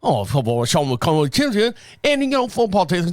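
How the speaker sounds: garbled imitation of a Scottish accent; thick mock accent. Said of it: "her accent got so thick".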